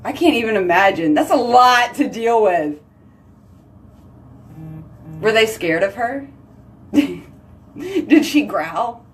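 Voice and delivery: ordinary talk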